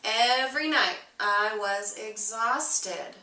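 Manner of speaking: ordinary delivery, nothing marked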